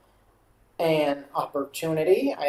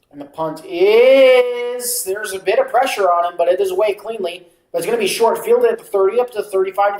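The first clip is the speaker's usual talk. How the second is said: live play-by-play; 5 dB louder than usual. Acted. Calling a punt return for viewers, play-by-play. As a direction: follow the punt with a building call.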